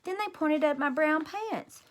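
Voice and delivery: usual speaking voice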